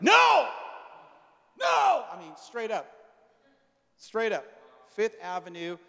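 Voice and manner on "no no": high, loud yelled no; retold shouted no. Pastor acting the men yelling no to prayer. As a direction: yell it, high and sharp.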